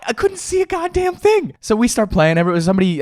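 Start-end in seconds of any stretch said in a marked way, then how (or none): none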